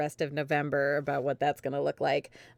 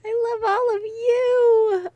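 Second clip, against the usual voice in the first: overwhelmed gush; 7 dB louder, slower than usual. Real, not acted. Emotional closing thanks to everyone.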